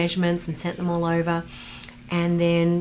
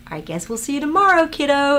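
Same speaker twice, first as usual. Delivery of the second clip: Brian Henson; 8 semitones higher, 6 dB louder than usual. Retold or imitated. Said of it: "and he's like".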